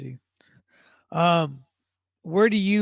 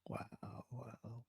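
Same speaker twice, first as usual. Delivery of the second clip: breathless whispered wow; 6 semitones lower, 23 dB quieter than usual. Real, not acted. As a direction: whisper it in disbelief. Stunned reaction to petty punishment.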